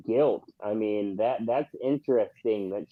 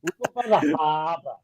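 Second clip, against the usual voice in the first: mock Boston accent; 3 semitones higher than usual. Joining the Boston parody.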